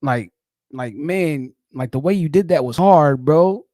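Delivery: mock bro hype. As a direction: praise like a hyped-up friend. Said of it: "if you say, like".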